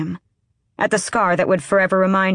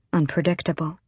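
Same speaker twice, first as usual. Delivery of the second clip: ordinary delivery, nothing marked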